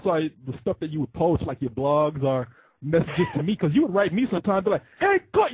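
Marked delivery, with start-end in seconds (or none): 5.0-5.5 high, loud all-caps greeting; mock yell. To Corey, imitating Ryan's all-caps message as a yell. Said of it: "it looks like you're yelling".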